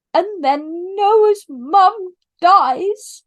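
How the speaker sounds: real tears rising as she says it; choked up. Real, not acted. Vlogger recapping the show, choking up over a death. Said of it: "I'm gonna ruin my makeup".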